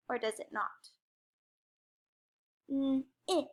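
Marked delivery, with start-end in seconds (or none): 2.7-3.5 "N-it" split as a held N, pause, then IT; segmented word. Shown as a demonstration. Teacher helping pupils hear the middle sound, segmented. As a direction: sound out "N-it" in two separate pieces. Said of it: "let's segment that".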